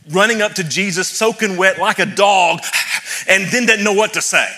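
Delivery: preaching energy rising; excited build. Real, not acted. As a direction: get carried away with Peter's eagerness.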